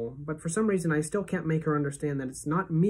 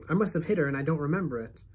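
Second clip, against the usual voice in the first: plain reading voice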